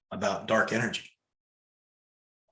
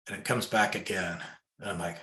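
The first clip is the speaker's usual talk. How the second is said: ordinary talk, nothing marked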